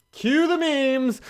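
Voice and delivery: high, slow, theatrical cue; mock announcement. Podcaster ending his rant with a high theatrical cue. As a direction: announce it like a showman.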